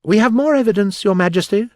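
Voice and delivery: high, loud, rapid announcement; great hurry. A narrator's reading. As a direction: burst in urgently. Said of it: "jumping up in a great hurry".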